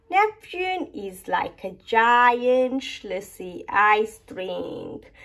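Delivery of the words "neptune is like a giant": plain reading voice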